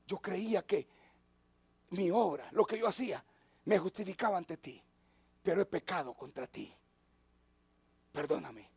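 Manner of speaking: a king's quiet plea for forgiveness; hushed repentant prayer. Acted. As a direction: confess it low and soft, as if barely daring to speak to God. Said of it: "Y dice".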